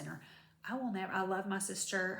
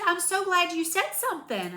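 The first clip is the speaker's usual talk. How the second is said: higher, louder glad clerk voice; imitating the clerk; 10 semitones higher, 8 dB louder than usual. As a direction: put on the clerk's delighted voice. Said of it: "she said".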